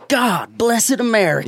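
high, slow mock Southern drawl; mimicking a Southern accent. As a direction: stretch it out in an exaggerated Southern accent. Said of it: "Ben's is the best accent to, like, mimic".

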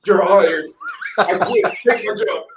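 loud, high-pitched hammed-up job offer; over-the-top excited surrender; mock-awed interviewer. Acted interviewer overwhelmed by the candidate.

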